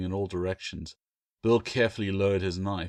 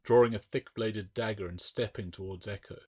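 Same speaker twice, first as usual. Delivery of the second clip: ordinary delivery, nothing marked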